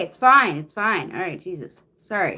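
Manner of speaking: ordinary delivery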